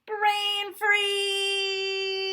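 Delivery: very high, loud yelp of brain freeze; shrieking brain-freeze yelp; pained shout. Acted. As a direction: cry out as the cold hits your head. Brain freeze from cold drink.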